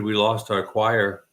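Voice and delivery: ordinary delivery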